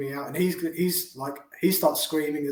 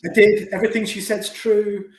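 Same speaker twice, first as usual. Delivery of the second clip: higher, louder, insistent retelling; loud mock confession; 7 semitones higher, 7 dB louder than usual. Killer owning up to the police, loud and insistent. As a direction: blurt it out loud and high.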